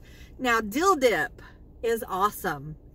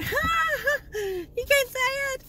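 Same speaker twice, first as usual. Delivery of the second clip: mocking viewers; 13 semitones higher, slower than usual. Viewers laughing at her saying tortilla, high mocking taunt. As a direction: tease like a gloating viewer, way up high. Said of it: "a lot of you out there is going".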